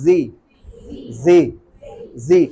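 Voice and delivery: American zee. Shown as a demonstration. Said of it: "American उसको बोलते हैं जी".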